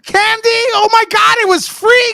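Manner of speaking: high, loud teasing outburst; mock exasperation. Teasing co-host over the candy complaint, high mock exasperation. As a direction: shriek in playful disbelief at a griping friend.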